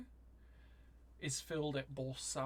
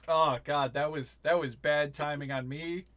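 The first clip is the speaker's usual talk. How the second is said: recovering from choking; 10 dB louder than usual. Real, not acted. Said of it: "I drank tea and it went down the wrong pipe".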